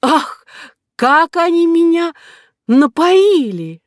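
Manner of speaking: very high, loud, slow tipsy cry; drunken exclamation. Acted. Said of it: "и воскликнула".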